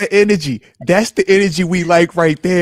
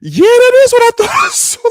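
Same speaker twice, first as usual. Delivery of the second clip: much higher, laughing disbelief; mock amazement at the replay; amazed; 4 dB louder than usual. Co-host retelling watching the clip, amazed. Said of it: "and it's like".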